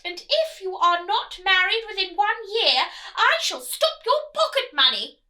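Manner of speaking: loud, high, haughty royal nagging; nagging queen. Acted. Queen nagging her son the prince, loud and high. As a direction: scold him like a bossy queen. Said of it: "the Queen had been nagging her son".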